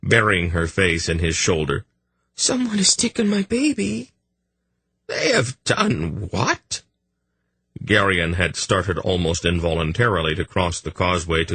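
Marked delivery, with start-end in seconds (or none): 2.3-4.1 put-on high, tearful young queen; sobbing. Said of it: "she sobbed". 5.1-6.8 shocked. Acted. Gorim shocked, slow.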